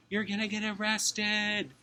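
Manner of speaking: high, slow mock yell at skateboarders; hammed-up guard's yell, pitched high and drawn out; mock angry yell. Guard yelling at skateboarders, a hammed-up high slow yell.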